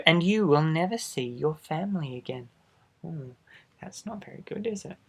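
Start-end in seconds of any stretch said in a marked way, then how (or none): 3.0-5.0 hushed aside. Acted. Aside to child listener.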